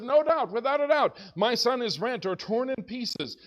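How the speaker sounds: a father sure his son is dead; anguished Jacob. Acted. Jacob crying out over his son's coat, anguished.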